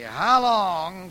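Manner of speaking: loud lament, rising at the end; anguished cry. Acted. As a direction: cry it out at God. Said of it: "the speech explodes".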